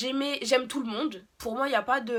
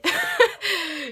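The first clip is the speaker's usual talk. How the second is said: rire aigu soudain; 10 semitones higher, 8 dB louder than usual. Real, not acted. Rire gêné en racontant ses larmes.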